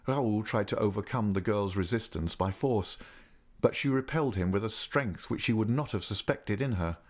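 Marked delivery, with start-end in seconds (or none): none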